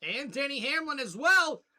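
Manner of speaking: loud, high race call; excited play-by-play. Acted. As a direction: call the pit stops like a hyped announcer.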